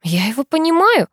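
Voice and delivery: ordinary delivery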